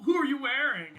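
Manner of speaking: high, loud, starstruck gush; gushing party guest. Acted. Party guest gushing over the player's armor, high and loud.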